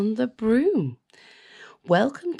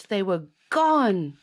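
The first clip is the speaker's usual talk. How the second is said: usual speaking voice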